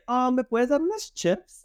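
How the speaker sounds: mock a fumbling Spanglish speaker; clueless Spanglish asker. Mocking someone ordering in Mexico, high and halting. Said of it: "y like".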